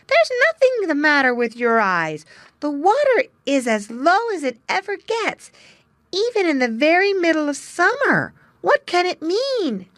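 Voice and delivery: put-on high, lively amazed young otter. A narrator's reading. Little Joe amazed.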